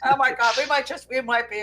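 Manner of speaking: ordinary talk, nothing marked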